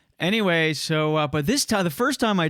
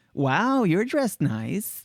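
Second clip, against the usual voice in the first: higher, swooping effeminate voice, slowing at the end; effeminate teen clerk; 5 semitones higher, much slower than usual. Retold or imitated. Popcorn server complimenting a customer's suit, effeminate voice. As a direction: draw out the compliment in an effeminate lilt. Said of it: "because of the effeminate way".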